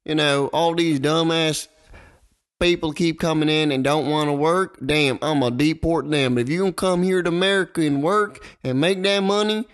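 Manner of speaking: mock Southern candidate making big promises; bad Southern accent. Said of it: "That was the worst Southern accent".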